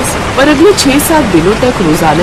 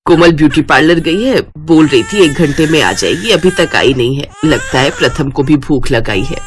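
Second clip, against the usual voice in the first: put-on low-pitched older woman, fretting a little; mother-in-law voice; 4 semitones lower than usual. Mother-in-law waiting for her daughter-in-law, low voice.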